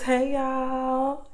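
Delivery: high drawn-out country hey; country accent. Shown as a demonstration. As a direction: sing out a Southern "hey, y'all". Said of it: "country accent".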